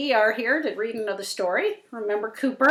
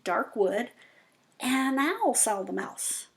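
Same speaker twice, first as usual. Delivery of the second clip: ordinary delivery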